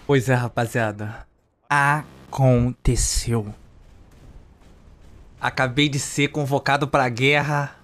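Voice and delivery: low, slow, grave movie-trailer voice; dramatic trailer voice. Acted. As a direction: announce the war like a film trailer. Dramatic war announcement.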